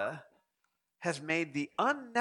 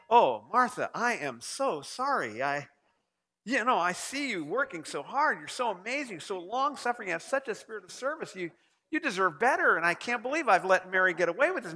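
hammed-up, effusive sympathy; gushing apology; 3 semitones higher, 3 dB louder than usual. Imagined Jesus consoling an overworked Martha, gushing apology. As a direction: pile on the apologies and flattery.

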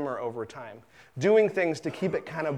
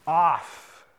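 slow parental order; much slower than usual. Retold or imitated. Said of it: "It's like".

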